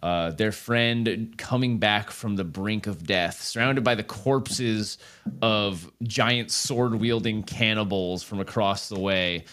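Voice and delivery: closing narration. Acted. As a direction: bring the episode to a solemn close. Season finale closing narration.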